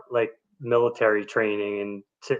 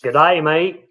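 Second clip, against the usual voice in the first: put-on Australian greeting, higher and louder; Australian accent; 5 semitones higher, 7 dB louder, slower than usual. Guest answering the host's rapid-fire challenge, Australian accent. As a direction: give it a playful Aussie twang. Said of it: "in an Australian accent".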